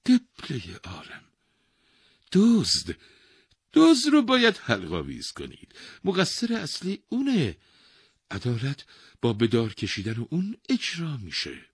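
high, loud, eager voice; happy old man. A narrator's reading. Old man pointing the king to the real culprit, happy. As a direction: pitch it up high with glad insistence. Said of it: "با خوشحالی گفت".

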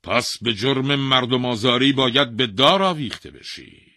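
loud, high royal verdict; angry king condemning the man to hang; stern king's sentence. Acted. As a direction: pronounce the death sentence harshly.

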